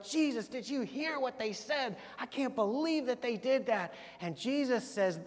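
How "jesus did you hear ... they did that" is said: mock indignant disciples. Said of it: "They're like".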